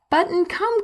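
high, eager call upward; excited call. Acted. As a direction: call her over, thrilled. Husband calls wife to bath.

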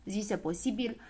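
plain reading voice

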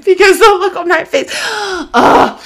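laughing in disbelief. Real, not acted. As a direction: gasp and laugh at the memory.

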